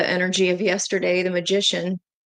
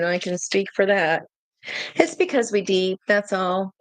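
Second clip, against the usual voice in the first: ordinary talk, nothing marked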